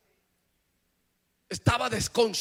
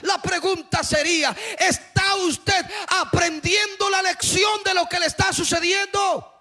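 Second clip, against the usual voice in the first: rising, louder and higher preaching cry; climbing sermon shout; fiery preaching; 7 semitones higher, 6 dB louder than usual. Acted. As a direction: preach it out to the whole church at full force. Preacher challenges at full force.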